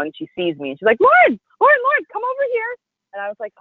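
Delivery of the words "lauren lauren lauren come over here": high, loud hostess hail; imitated calling-out. Hostess spotting her at the dinner, excited calling-out. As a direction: call across the room, high and eager.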